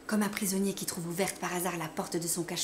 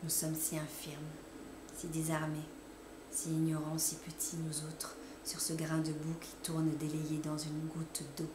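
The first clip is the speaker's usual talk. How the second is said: soft, lower and hushed; hushed lament; 3 semitones lower, 7 dB quieter than usual. A narrator's reading. Narrator reflects on human frailty, hushed and low. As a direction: shrink the voice to a murmur.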